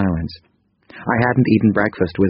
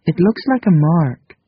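higher, gentle female character voice; 10 semitones higher, 3 dB louder than usual. A narrator's reading. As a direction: voice Krita Kano lightly higher. Narrator voices Krita Kano.

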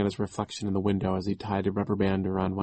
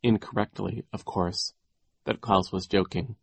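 ordinary delivery, nothing marked

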